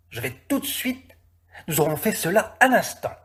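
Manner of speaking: high, breathless eager voice; eager coachman. Acted. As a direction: hurry to please the master. Eager coachman voice, much higher.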